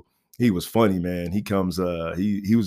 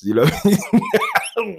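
laughing; 12 semitones higher, faster than usual. Real, not acted. Laughing repeat of his line.

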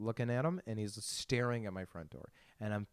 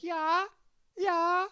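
much higher, louder breathy yeahs, put on; mock porn star voice; 8 dB louder than usual. Mocking the porn star in the video, squeaky high yeahs. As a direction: ham up her squeaky yeahs. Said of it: "she's just like".

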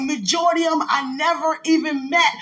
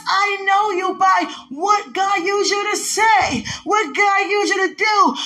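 full-force preaching; 5 semitones higher than usual. Acted. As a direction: shout it like a Sunday sermon.

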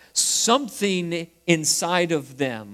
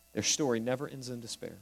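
softer, faster echo of the line; quiet repeat; 3 semitones lower, 10 dB quieter, faster than usual. Shown as a demonstration. Preacher repeats the point to the congregation, softer and quicker. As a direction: let it sink in, softly and quickly. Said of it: "but their story never ends in despair".

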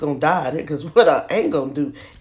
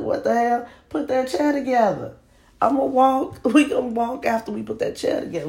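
high, indignant squeal; exasperated outburst; 8 semitones higher than usual. Retold or imitated. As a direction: fire it back high and fast, fed up. Retelling exasperated answer to husband.

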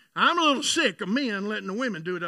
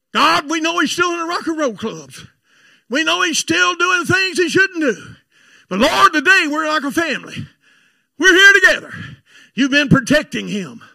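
loud, raised mock family prayer; imitated fervent grace, swelling louder; Thanksgiving prayer; 7 semitones higher, 10 dB louder than usual. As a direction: pray pointedly over a wayward relative. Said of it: "the prayer and the Thanksgiving".

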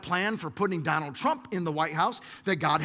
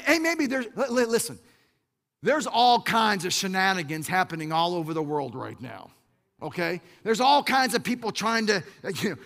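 ordinary delivery

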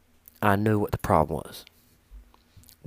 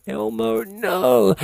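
high-pitched laughing disbelief, getting louder; mock incredulous; 9 semitones higher, 5 dB louder, much slower than usual. Owner rejecting the vet's Elmo suggestion, high incredulous refusal. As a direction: scoff in a raised, put-on voice.

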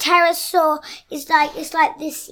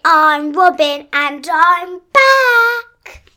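slow, loud, big announcer; loud announcer intro; 4 semitones higher, 9 dB louder, much slower than usual. Acted. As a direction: announce it grandly.

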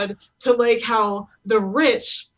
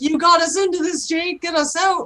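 Marco; 8 semitones higher than usual. Acted. Acts Marco blaming Jake.

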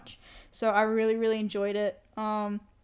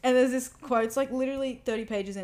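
ordinary talk

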